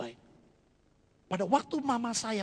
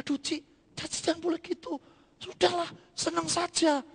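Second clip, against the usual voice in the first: high, coaxing neighbour voice; soothing neighbour; 10 semitones higher than usual. Retold or imitated. Neighbour urging the sad mother to cheer up, high and coaxing. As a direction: sweet-talk her out of her worry, pitched up. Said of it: "Jadi dia bilang".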